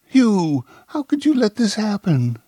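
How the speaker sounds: high, loud distraught reproach. A narrator's reading. Woman reproaches two accomplices over a death, distraught. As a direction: cry out in dismay, pitch far up. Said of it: "Monica was distraught".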